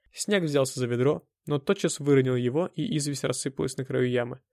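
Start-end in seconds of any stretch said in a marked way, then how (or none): none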